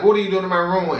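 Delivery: high-pitched mock outrage; retold yelling. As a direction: re-enact confronting an intruder. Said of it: "like, yelling, cussing at him".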